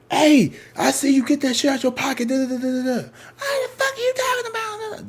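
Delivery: high, loud mock tirade; clerk going off. Clerk going off on a woman at the register, high mock tirade. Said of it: "The clerk is going off on this woman".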